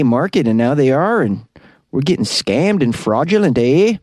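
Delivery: singsong mock Canadian accent; bouncy Canadian accent, each "eh" tossed upward. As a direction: quote him in a hammed-up Canadian lilt. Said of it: "What do you guys think of this Canadian accent?".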